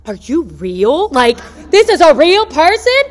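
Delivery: high, loud mock astonishment; amazed disbelief. Caller finally reaches a live person, acted loud amazement. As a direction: burst out, slow then rising in disbelief.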